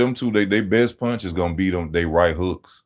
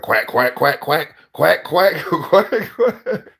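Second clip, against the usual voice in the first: quacks rising higher, breaking into a laugh; imitating Kovalev's duck quacks; 7 semitones higher, much slower than usual. Kovalev mocking Adonis Stevens as a duck, laughing quacks. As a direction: taunt like a duck, laughing through it. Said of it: "Kovalev ... mention Adonis Stevens' name, like".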